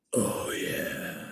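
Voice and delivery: soft, breath-only biker moan; mock moan of creepy enjoyment; imitated pleasured moan. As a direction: moan it low and hushed, drawn out. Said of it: "Literally just like that".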